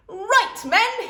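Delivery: high, loud army order; commanding. A narrator's reading. Dad ordering kids like troops, loud command. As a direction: bark it like a sergeant. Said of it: "he commanded".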